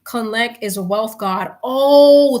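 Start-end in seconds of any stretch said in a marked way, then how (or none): 1.6-2.4 surprised oh. Real, not acted. Surprised at being corrected.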